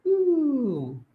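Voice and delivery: long, very high ooh gliding down; impressed, swooping ooh; drawn-out ooh from up high; high falling "ooh". Shown as a demonstration. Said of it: "so that you sound".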